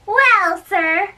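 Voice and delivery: a high, pointed "Well, sir"; a servant goading his rival; defiant retort. Acted. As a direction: toss the words back as a challenge.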